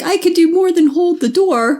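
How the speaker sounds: a hint of a plucky mechanic; lightly voiced Rock. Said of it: "Rock's like".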